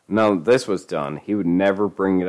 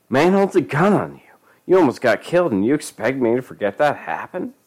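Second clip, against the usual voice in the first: angry scolding; 6 semitones higher than usual. Acted.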